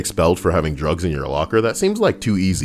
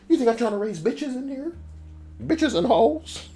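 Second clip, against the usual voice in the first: mock outraged; 7 dB quieter than usual.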